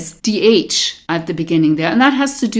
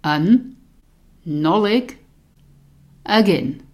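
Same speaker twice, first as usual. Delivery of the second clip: slow, word by word, spaced pauses; over enunciating; slower than usual. Shown as a demonstration. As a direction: split tá an nollaig again into separate words. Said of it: "I say it slowly again".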